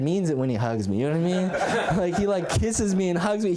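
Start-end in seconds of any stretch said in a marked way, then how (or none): none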